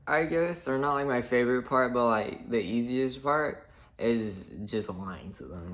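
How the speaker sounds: ordinary talk, nothing marked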